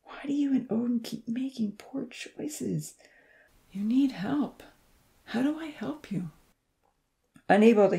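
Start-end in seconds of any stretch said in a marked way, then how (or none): 0.0-6.3 murmured. A narrator's reading. Jax watching the tinies leave, soft sorrowful whisper. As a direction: murmur it to yourself, grieving. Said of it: "Jax murmured".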